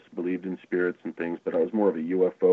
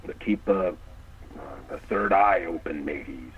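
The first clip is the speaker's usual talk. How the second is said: put-on whispering old sailor; whispered pirate voice; 5 dB quieter, slower than usual. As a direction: hush it like a secretive pirate. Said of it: "I love your pirate impression".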